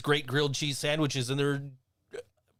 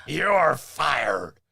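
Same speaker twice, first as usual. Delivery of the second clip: mild wrestling-boss put-down, a bit louder; lightly voiced Vince McMahon firing someone; 4 dB louder than usual. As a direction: fire them like a TV boss. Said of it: "Vince McMahon impression".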